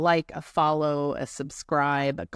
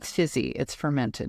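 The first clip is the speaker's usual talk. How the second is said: ordinary talk, nothing marked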